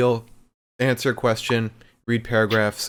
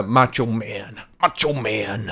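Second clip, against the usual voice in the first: Macho Man voice; slower than usual. Retold or imitated. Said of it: "I would impersonate".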